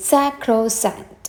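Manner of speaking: "Sacrosanct" slowly, syllable by syllable; measured "Sacrosanct" with a falling end; pronunciation demo. Shown as a demonstration. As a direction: model "Sacrosanct" for learners to repeat. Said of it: "ഒന്ന് പറഞ്ഞു നോക്കിയേ".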